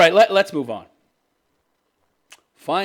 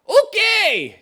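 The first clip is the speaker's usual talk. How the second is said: dopey prince voice; 6 dB louder than usual. Retold or imitated. Voicing the prince answering.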